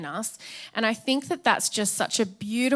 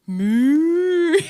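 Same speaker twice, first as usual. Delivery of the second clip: put-on higher and louder little-toddler moo; baby's moo; 7 semitones higher, 8 dB louder than usual. Baby Justice answering mum with a cow sound, cute toddler voice. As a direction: squeak it out like a delighted baby.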